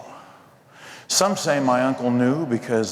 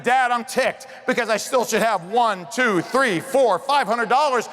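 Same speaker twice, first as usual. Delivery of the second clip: indignant shout; 11 semitones higher than usual. Retold or imitated. Son shouting his complaint to his dad, in mock outrage. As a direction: yell it like a cheated kid.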